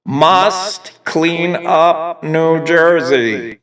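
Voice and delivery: slow, loud mechanical robot voice. Pretend. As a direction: boom it out like a robot. Robot voice again.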